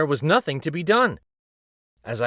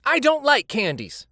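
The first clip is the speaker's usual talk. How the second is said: put-on boy's line; 10 semitones higher than usual. A narrator's reading. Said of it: "He said".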